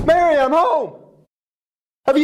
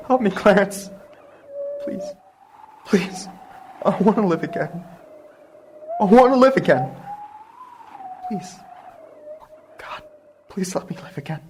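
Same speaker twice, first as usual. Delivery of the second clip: softer, pleading near-sob; broken prayer; 6 dB quieter than usual. Acted. George prays softly to God, broken. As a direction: beg quietly, almost out of strength.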